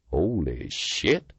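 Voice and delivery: slow, impressed exclamation; appreciative. A narrator's reading. Partner admiring her fighting moves, impressed. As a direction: savour the moment with admiration. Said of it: "Carlin's appreciative voice".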